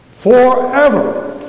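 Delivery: Forever drawn out slow and loud; slow preached emphasis. Shown as a demonstration. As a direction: stretch Forever and lean on it hard. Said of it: "One more time".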